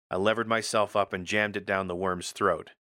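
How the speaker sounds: plain reading voice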